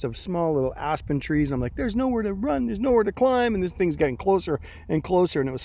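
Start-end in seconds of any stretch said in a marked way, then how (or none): 1.8-3.5 higher-pitched retold panic; panicked. Man recounting being chased through aspens, mock panic. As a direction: act out mild panic at being trapped.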